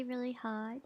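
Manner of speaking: ordinary delivery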